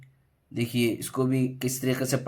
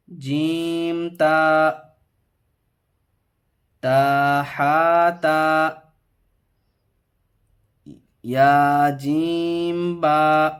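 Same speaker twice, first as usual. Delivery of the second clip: drawn-out, sing-song reading of letter names; slow letter recitation; 5 semitones higher, 7 dB louder, much slower than usual. Shown as a demonstration. Teacher reading a Qaida lesson aloud, slow sing-song recitation. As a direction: chant each letter slowly for the pupil.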